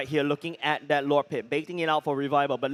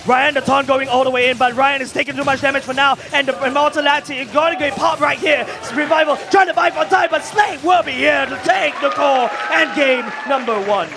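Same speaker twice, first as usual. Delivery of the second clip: loud, high shoutcast finish; hyped play-by-play; 8 semitones higher, 9 dB louder than usual. Real, not acted. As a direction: yell the game-winning push. Shouted game-ending call.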